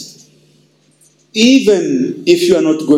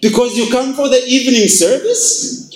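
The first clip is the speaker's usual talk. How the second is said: mock disbelief; 9 semitones higher than usual. Pastor mocks the churchgoer's answer.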